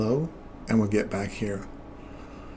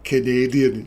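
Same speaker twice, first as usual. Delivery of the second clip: usual speaking voice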